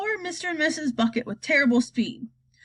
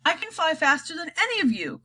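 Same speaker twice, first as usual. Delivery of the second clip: boastful cry; 6 semitones higher than usual. A narrator's reading. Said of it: "cried Grandpa George".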